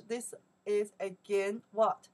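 ordinary talk, nothing marked

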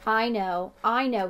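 loud eager shout. A narrator's reading. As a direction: blurt it out, dying to answer. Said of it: "he shouted".

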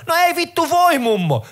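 fed up, bark it back at her; mock angry retort. Snapping back at the granny.

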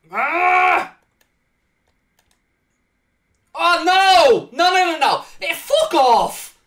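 loud, high anguished shouts at the screen; furious yell. Real, not acted. Gamer yelling at his screen as a goal goes in, furious. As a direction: bellow in disbelief as the goal goes in.

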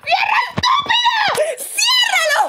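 frantic shout at the stuck door; panicked yell. Real, not acted. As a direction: scream at the door to shut. Yelling at the game to close.

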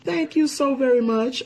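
high, bright burst of thanks; excited. Real, not acted. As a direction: gush your thanks, way up high. Co-host greets viewers enthusiastically.

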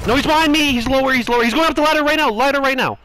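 high, loud panicked callouts; panicked shouting. Real, not acted. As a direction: yell the warning in a panic. Panicked callout, enemy behind.

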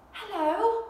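excited cry. Acted. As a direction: call out in delight at seeing a friend. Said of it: "cried the bear".

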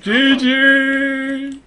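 loud, high, drawn-out cheer; victorious shout. Real, not acted. Player salutes team after winning, victorious shout. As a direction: holler it in triumph. Celebrating end of the match.

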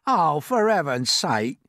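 slow, drawn-out exasperation; exasperated earthworm. A narrator's reading. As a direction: groan it slowly as a fed-up worm. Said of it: "said the earthworm".

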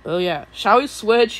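ordinary talk, nothing marked

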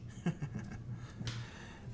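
quiet, breathy scheming laugh; hushed acted evil chuckle; villain's chuckle. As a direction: gloat softly in a villain's laugh. Villain Babidi gloats over his plan.

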